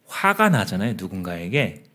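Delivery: normal talking voice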